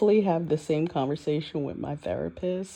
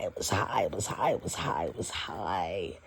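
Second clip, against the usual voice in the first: breathy, much softer whispered repeat; hushed whisper; 9 semitones lower, 9 dB quieter than usual. Retold or imitated. Echoing the frightened people around her, a soft whisper. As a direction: drop to a secret whisper, fading out. Mock-hushed repetition of reading.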